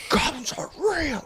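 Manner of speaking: very high, strained squawk; mock exasperated outburst. Husband snapping at wife's goblin talk, high mock outburst. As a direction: squeal it as if losing patience. Husband escalating, then drunk and violent.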